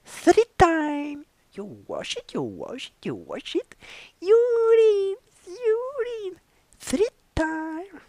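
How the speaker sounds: high hammed-up cry; shrill mock shriek. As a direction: squeal it like a crazed cleaner.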